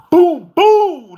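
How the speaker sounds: loud high boom boom; loud mock crash noise; imitated banging. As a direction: bang it out like dogs hitting the cage. Imitating dogs slamming cage.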